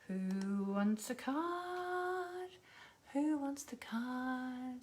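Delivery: very slow, drawn-out call; sing-song offer. Real, not acted. Host asking viewers who wants a card, drawn-out sing-song. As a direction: stretch it out invitingly, softly sing-song.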